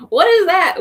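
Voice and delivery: loud, high imitated puzzlement; mock bewilderment. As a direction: blurt it high and loud through amusement. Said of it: "And they're like".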